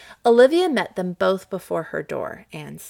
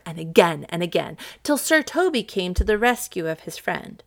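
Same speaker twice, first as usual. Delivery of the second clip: ordinary delivery, nothing marked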